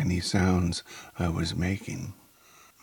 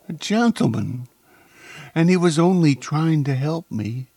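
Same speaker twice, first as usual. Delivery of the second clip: high, anguished lamenting; distressed lament; 10 semitones higher, 6 dB louder than usual. A narrator's reading. Woman above the floating body laments the death, distressed. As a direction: wail the words in raised-pitch dismay. Woman mourns over the supposed corpse.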